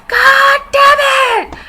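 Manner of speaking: high, loud, slow stretched yell; Jake freakout. Retold or imitated. Imitating Jake freaking out, a drawn-out high yell. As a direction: explode like a panicking dad. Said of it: "he says".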